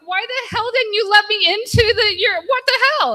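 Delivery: high, loud mock-indignant friend; annoyed friend. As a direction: sound put out at being left outside. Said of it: "he's like".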